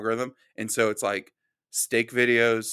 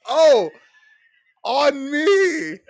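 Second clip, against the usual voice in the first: high, loud fake wail; mock cry; 13 semitones higher, 9 dB louder, much slower than usual. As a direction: wail it out like a wounded clown. Mock cry after joke.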